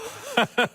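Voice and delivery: short high mocking laugh; a quick mocking laugh at his captive; high scoffing laugh. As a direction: scoff at him with a sharp laugh. Villain mocks his captured son.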